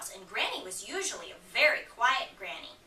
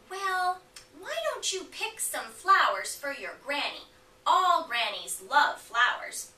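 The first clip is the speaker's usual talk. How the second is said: coaxing wolf; 4 semitones higher, 6 dB louder than usual. Acted. Wolf coaxes her to pick flowers.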